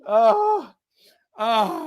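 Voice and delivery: high, breathless laughing apology; real laughing fit, eyes watering; laughing to tears. Real, not acted. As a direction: apologise while still cracking up. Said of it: "now I'm seriously crying".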